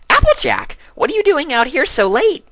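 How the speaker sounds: very high, loud, bubbly squeal of surprise; startled party pony shrieking at her friend; Pinkie Pie voice. A narrator's reading. Pinkie startled by Applejack, squealing high.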